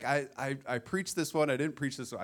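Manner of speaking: playing John all worked up; flustered retelling. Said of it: "he was like".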